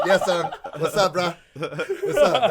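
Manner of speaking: laughing loud greeting. Retold or imitated. Answering phone from afar, laughing.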